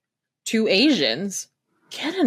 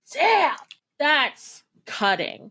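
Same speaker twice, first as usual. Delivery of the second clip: impressed damn; 6 semitones higher, much slower than usual. Retold or imitated. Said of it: "And I was like".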